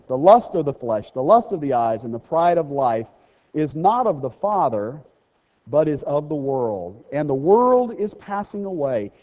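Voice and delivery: measured preacher's scripture read. Acted. Reading scripture aloud.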